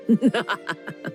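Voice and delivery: a knowing creepy chuckle; creepy witch laugh. Acted. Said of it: "this fortune teller's laugh is so creepy".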